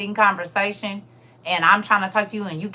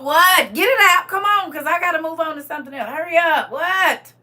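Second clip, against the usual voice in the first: loud and much higher, pushing hard; impatient demand; 9 semitones higher, 8 dB louder than usual. Retold or imitated. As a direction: rush the friend to get it out. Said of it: "I do that to Nathan and Joseph and Will".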